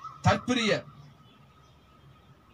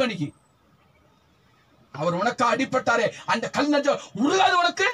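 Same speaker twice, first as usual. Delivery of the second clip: fiery preaching; 3 semitones higher than usual. Acted. Preacher pressing the church about tears, full-force preaching. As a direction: preach it hard at the congregation.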